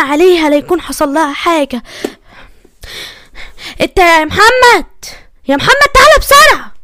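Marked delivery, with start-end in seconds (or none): 3.8-6.7 loud, rising call for someone to come; urgent calling out. Acted. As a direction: call out sharply to summon him fast. (نداء عالي ومستعجل)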